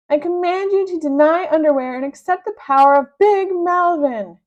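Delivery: high, loud shouted command; a bossy villain shouting demands. Acted. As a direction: order him around at full volume. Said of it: "he shouted".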